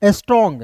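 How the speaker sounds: स्ट्रॉन्ग को साफ़ अंग्रेज़ी में स्ट्रॉन्ग बोलें; "स्ट्रॉन्ग" with a clear ng at the end; English pronunciation. Shown as a demonstration. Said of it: "पढ़ेंगे". ("स्ट्रॉन्ग" का शुद्ध उच्चारण)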